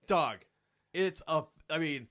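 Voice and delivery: ordinary delivery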